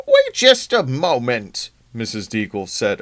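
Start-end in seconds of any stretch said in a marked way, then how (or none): 0.1-1.7 put-on slow, loud, haughty old woman; imperious old lady. Missus Deagle halts the boss, loud haughty old woman. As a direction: command the room, imperious. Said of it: "to assert herself".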